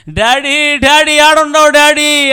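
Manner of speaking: put-on high, loud crying child's call; crying for daddy. Lost little son calling for his father, crying loudly. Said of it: "అని ఏడుస్తున్నాడు".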